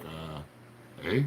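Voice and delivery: low, soft Canadian eh; mock Canadian eh. Answering Bev with a quiet Canadian eh. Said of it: "I'm like".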